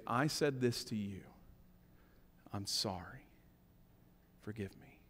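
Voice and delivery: soft, hushed apology; low, soft plea for forgiveness; quiet confession. Shown as a demonstration. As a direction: say it gently, almost to yourself. Softly models confession.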